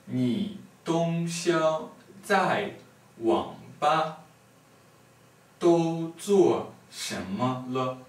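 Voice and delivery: langsam, Silbe für Silbe. Shown as a demonstration. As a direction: zum Mitlesen langsam vortragen. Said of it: "this time, uh, slowlier".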